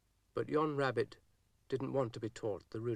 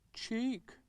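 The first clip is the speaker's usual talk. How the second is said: train's cheeky voice; 12 semitones higher than usual. A narrator's reading. Train voiced with one word.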